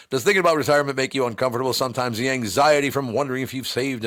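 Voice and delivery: brisk, fast straight ad read; plain ad read. Shown as a demonstration. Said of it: "if I read the commercial like that".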